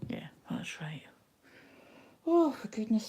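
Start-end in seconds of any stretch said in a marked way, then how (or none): 0.1-1.0 whisper. Real, not acted. Quiet self check while stitching.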